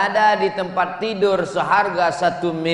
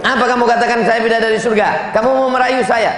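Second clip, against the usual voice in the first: higher, louder suspicious retort; suspicious wife; 5 semitones higher, 5 dB louder, faster than usual. Retold or imitated. Wife suspects husband's flattery, louder high retort. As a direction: challenge him as the wife.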